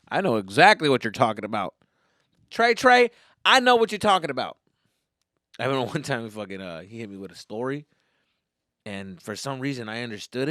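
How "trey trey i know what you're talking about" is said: higher, louder put-on swagger; mock street talk. Assuring Trey he is understood, in put-on street talk. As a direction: call out to him in a cocky raised voice.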